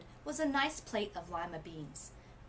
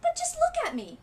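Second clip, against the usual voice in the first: put-on distressed girl; 10 dB louder than usual. Girl upset at her stripes.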